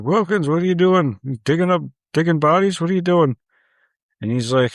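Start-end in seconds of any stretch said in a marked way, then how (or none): 0.0-3.4 loud, high mock hollering; neighbor calling out. Neighbor through the fence to Wilkins, calling out. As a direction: imitate a nosy neighbor shouting over.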